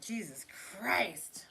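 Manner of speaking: whispered Jesus Christ; slow hushed exasperation; exasperated whisper. Real, not acted. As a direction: breathe it out, exasperated. Exasperated aside.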